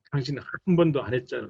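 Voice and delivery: fast, rushed accusing jab; snapping accusation. Retold or imitated. Wife snapping back at husband about chores, fast and accusing. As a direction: rattle off the blame quickly. Acted marital quarrel retort.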